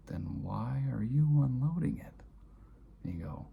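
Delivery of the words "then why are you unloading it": high and loud, exasperated; exasperated question. Retold or imitated. Son confronting his father over the dishwasher, high and exasperated. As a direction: press him, baffled and frustrated.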